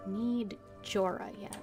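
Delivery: usual speaking voice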